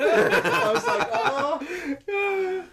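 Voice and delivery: very high, drawn-out "oh"; loud, laughing fake sob; mock wail. Retelling crying at the trailer a second time, mock wailing. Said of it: "like, teared up".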